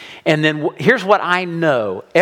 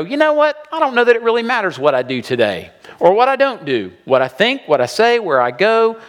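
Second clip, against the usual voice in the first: careless shrug; 7 semitones higher, faster than usual. Retold or imitated. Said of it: "you just sort of go".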